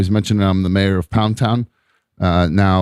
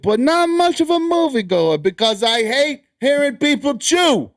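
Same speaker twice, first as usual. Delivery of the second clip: reading as cousin Joey; 7 semitones higher than usual. Retold or imitated. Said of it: "that's how he sounds".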